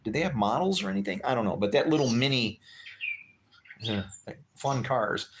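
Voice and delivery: ordinary delivery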